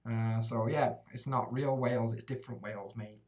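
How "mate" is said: ordinary talk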